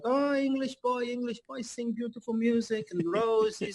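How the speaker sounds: high mock Polish fan voice, Polish accent. Polish fans calling to the English singer, mock Polish accent. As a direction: ham up a Polish fan's broken English. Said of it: "it would be like".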